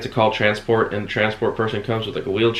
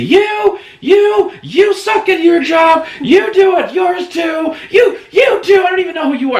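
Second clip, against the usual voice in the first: screaming it out at the nurse, high and loud; loud shrill-pitched tirade, acting out the rage; furious yelling; 8 dB louder than usual. Sedated patient yelling at her nurse, furious shout. Janice was pissed.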